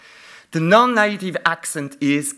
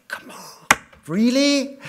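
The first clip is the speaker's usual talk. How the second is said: mock your own eye-rolling reaction; exasperated disbelief; 10 semitones higher, slower than usual. Reading the paper, lecturer scoffs in disbelief. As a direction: scoff it, then a high incredulous "really?". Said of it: "I say".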